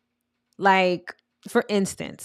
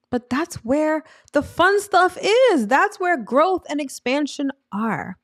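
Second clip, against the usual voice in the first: higher, louder, slowed for emphasis; excited; 7 semitones higher, 4 dB louder, slower than usual. Real, not acted. Urging viewers past their comfort zone, excited. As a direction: sell the thrill of growth.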